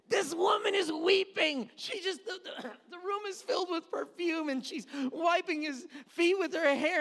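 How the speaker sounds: high, strained exclamation; worked-up disbelief. Acted. Bystander protesting that this woman is weeping, worked-up disbelief. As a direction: pitch it way up, aghast at the timing.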